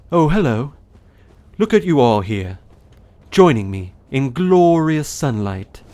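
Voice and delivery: hearty, exaggerated knightly character voice; Solaire. Shown as a demonstration. Streamer shows an audiobook voice for a knight, exaggerated. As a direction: greet everyone grandly, stretching the key words. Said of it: "I would read his voice more like".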